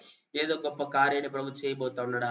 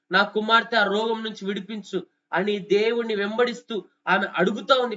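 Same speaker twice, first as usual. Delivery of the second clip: higher, louder impassioned preaching; full-force preaching; 5 semitones higher, 4 dB louder than usual. Acted. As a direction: preach it out, pleading for the mother.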